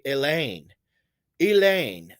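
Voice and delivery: Elaine with a big, drawn-out LAINE; exaggerated second-syllable stress. Shown as a demonstration. Teacher showing stressed syllables, exaggerated stress on the LAINE of Elaine. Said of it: "when I exaggerate the way I say Elaine".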